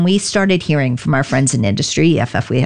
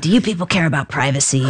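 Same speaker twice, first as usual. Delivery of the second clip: quick, lightly imitated raspy stranger; hint at a raspy New York voice, briskly; raspy New Yorker; faster than usual. Trench-coated man at the front door, raspy New Yorker. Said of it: "he has this raspy voice".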